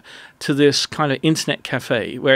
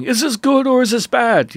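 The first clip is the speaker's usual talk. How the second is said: high, louder eager question; excited puzzled question; 10 semitones higher, 5 dB louder than usual. Retold or imitated. Friend asks Gary about the news, high and excited. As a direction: ask it all worked up and unsure. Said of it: "he was going".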